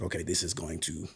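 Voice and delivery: ordinary talk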